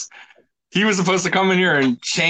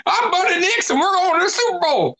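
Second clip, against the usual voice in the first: whiny country voice. Retold or imitated. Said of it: "a whiny country voice".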